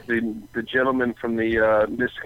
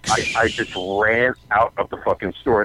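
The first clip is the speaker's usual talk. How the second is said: ordinary talk, nothing marked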